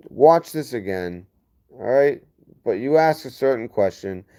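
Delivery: normal talking voice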